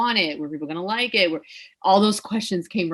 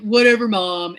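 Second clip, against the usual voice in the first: louder mock brush-off; dismissive daughter; 2 semitones higher, 7 dB louder than usual. Daughter to her nagging mom, loudly dismissive. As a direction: roll it off like a fed-up daughter. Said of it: "the typical, like, mother-daughter, I'd be like".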